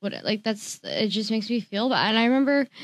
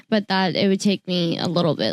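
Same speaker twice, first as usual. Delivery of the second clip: normal talking voice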